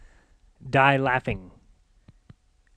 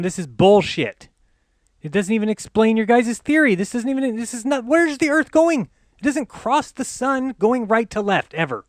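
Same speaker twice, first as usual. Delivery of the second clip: exasperated rant; 7 semitones higher than usual. Real, not acted. Ranting at the animators over a fake eclipse, furious. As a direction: rail at the screen in disbelief.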